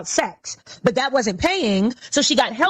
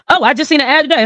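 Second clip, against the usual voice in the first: ordinary talk, nothing marked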